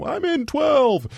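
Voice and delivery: high, slow call from afar; drawn-out mock yell from next door; calling from another room. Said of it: "I hear the voice yelling from the next room".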